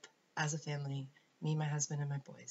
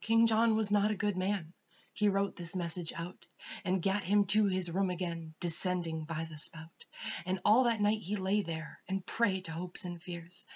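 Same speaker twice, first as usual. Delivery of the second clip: louder, animated storybook poem reading; poem recital; 3 semitones higher, 7 dB louder than usual. A narrator's reading. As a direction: narrate the verse with strong, punched rhythm. Reads a children's poem aloud.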